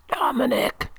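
faint, hoarse whisper of a dying man. A narrator's reading. Dying old father wakes his dozing son, hoarse whisper. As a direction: breathe the name out hoarsely, high and thin. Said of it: "he whispers hoarsely".

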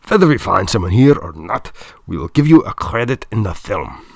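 put-on loud, firm German-accented offer; German director, offering a deal. A narrator's reading. Director offering Mister Lopat an exchange, firm German accent.

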